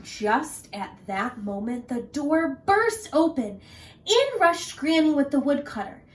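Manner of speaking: plain reading voice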